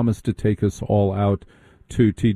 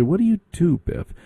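higher snooty "What do you do, Biff?"; mock preppy question; 8 semitones higher than usual. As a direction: lightly sneer the question like a prep. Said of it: "They say".

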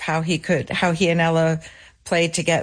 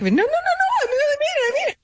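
high squeaky falsetto, much higher, fast; faster than usual. Retold or imitated. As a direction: squeal it up in a soprano like an excited bassist. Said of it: "[name] would always say he became a soprano".

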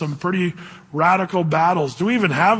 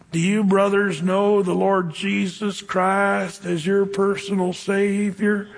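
slow, earnest Southern drawl; slower than usual. Retold or imitated. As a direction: ask it slowly, Southern and sincere. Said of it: "talked really slow like this with a southern drawl".

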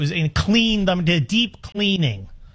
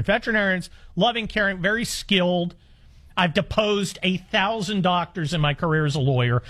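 normal talking voice

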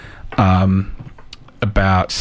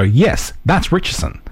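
confident recognition; 6 semitones higher than usual. Retold or imitated. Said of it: "look at it now and go".

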